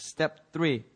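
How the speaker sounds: ordinary talk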